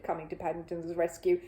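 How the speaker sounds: usual speaking voice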